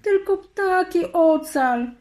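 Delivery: high, slow plea; begging. A narrator's reading. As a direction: beg him for the birds alone. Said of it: "błagała Sawa".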